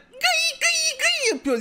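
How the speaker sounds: very high, slow squeaky fiddle noise; a high screeching mock violin; screechy violin imitation. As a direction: mimic a violin played off-key. Morgoth's off-key violin imitated.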